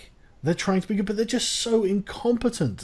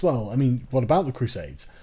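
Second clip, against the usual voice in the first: lower-pitched, lightly mocking critic's voice; mock smug objection; 8 semitones lower than usual. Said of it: "who thinks".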